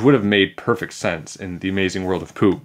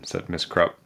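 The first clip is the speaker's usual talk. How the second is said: ordinary delivery, nothing marked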